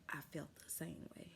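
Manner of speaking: hushed secret whisper. Retold or imitated. Nurse secretly agreeing with the patient, whispering. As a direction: breathe it like a confidence.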